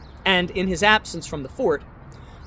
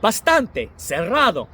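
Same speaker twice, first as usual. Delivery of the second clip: put-on "Bastante, cerrado." in a heavy Spanish accent, a touch louder; 3 dB louder than usual. Narrator voicing Columbus, heavy Spanish accent. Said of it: "in a heavy, genuine accent".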